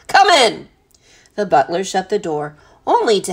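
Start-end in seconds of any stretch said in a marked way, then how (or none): 0.1-0.7 high, loud bellowed command; captain bellowing an order; cannon roar. A narrator's reading. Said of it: "in a voice like the roar of a cannon".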